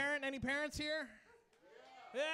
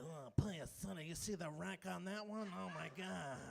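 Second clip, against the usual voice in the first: lightly done low mobster mumble; a hint of the Godfather, low and soft; Don Corleone; 5 semitones lower, 6 dB quieter than usual. Comic imitating Don Corleone ogling a woman, low and soft. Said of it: "Got Don Corleone turned on".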